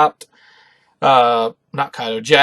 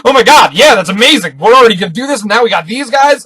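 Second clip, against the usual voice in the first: excited; 7 semitones higher, 8 dB louder than usual. Retold or imitated. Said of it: "Usopp was definitely like".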